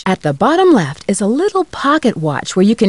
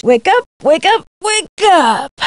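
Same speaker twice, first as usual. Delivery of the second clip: high, insistent repeated call; urgent wake-up call; 8 semitones higher than usual. Acted. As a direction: call out higher to rouse someone. Urging awake.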